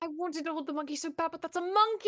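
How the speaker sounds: high, rising burst of indignation; excited protest. Real, not acted. Player defends wanting to hold the monkey, excited high protest.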